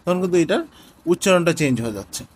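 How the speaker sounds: ordinary talk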